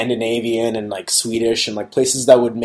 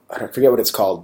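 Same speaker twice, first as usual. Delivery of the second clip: usual speaking voice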